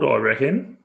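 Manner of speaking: ordinary delivery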